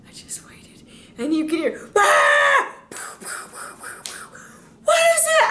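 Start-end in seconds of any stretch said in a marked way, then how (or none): 0.1-0.9 hushed whisper. Shown as a demonstration. Pastor waiting in hiding for the prank, whispered. Building suspense before the prank. 2.0-2.8 scared scream. Retold or imitated. Friend sees the Smurf. 4.8-5.5 panicked cry. Retold or imitated. Friend panics at the Smurf.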